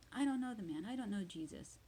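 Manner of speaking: high-pitched, emphatic denial, easing at the end; sworn denial. Acted. Peter swearing to those who accuse him, emphatic denial. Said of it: "And then he swears".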